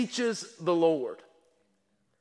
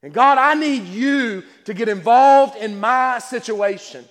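louder, slow, building fervent plea; passionate prayer; 3 semitones higher, 5 dB louder, slower than usual. Acted. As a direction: cry out to God with rising urgency. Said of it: "You can hear the passion".

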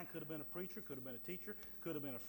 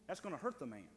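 usual speaking voice

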